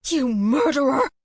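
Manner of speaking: high, loud, rising cry of murderer; accusing shout. A narrator's reading. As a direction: hurl murderer at the husband. Waking up accusing the couple.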